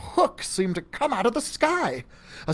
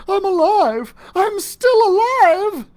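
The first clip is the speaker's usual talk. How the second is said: high, loud, slow relieved cry; overjoyed relieved cry; cry of relief; 14 semitones higher, 8 dB louder than usual. A narrator's reading. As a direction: shout it out kissing the floor in relief. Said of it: "I cried with relief".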